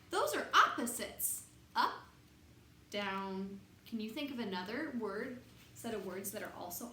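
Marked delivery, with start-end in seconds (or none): none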